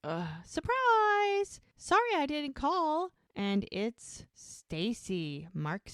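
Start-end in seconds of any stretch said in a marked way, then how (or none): 0.4-3.1 high, bright sing-out of a surprise arrival; acted chirpy announcement at the door; surprise greeting. Sister turning up unannounced, high acted surprise greeting.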